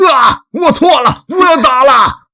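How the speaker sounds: high, wailing plea; drawn-out, high-pitched pleading shout; begging for mercy. Acted. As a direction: cry out under the blows. Beaten kidnapper begs for mercy.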